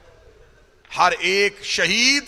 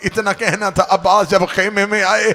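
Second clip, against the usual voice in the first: ordinary talk, nothing marked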